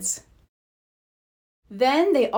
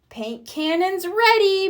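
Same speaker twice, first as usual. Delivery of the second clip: announcing; 8 semitones higher than usual. A narrator's reading. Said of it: "Marshall announced".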